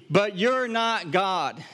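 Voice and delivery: but you're not God, slowed and weighted; Jesus repeating the point, slow firm emphasis; slow emphatic. Retold or imitated. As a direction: drive it home slowly. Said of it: "It's as if he is saying".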